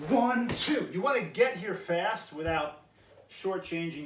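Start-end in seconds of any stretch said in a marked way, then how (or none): 0.1-0.9 one, two called out loud, punchy count. Shown as a demonstration. Instructor counting the class loudly through the kick. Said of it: "make sure".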